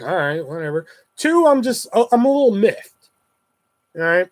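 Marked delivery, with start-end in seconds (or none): 0.0-0.9 unbothered shrug. Retold or imitated. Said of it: "so you get one for free".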